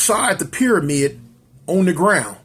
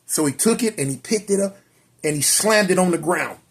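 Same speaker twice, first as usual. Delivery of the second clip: ordinary delivery, nothing marked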